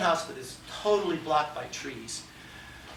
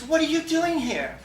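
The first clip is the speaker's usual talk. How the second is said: high, louder startled demand; shocked question; 11 semitones higher, 4 dB louder than usual. Retold or imitated. Girlfriend startled to find him in garage, high shocked demand. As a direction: blurt it out in shock, pitched up.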